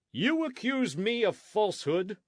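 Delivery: put-on outraged old friar protesting; indignant cry. A narrator's reading. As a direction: cry it out, deeply offended. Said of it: "Fray Felipe cried".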